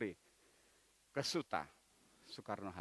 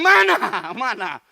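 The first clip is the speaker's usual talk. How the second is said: mock complaint; 14 dB louder, slower than usual. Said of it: "Kita berdoa kepada Tuhan".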